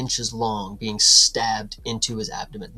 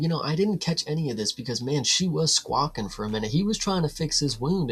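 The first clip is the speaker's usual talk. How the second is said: ordinary talk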